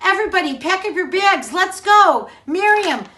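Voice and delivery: rally the crowd to leave; excited call. Acted. Moses calls the people out.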